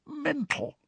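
a thin squeezed word of despair; croaky Ron. A narrator's reading. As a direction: Ron echoing himself in a high hushed croak. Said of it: "he said in a croaky whisper".

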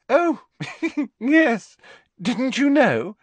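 high, giggling, gushing reply; giggly. A narrator's reading. Hermione showing off to Parvati, giggling. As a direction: giggle through it girlishly. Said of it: "with a most un-Hermione-ish giggle".

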